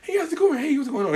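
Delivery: high, quiet mimicked greeting; coworker's morning voice. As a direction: imitate him politely greeting down the line, high and soft. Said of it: "Like, this is his voice".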